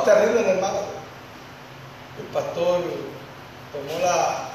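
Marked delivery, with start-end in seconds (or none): none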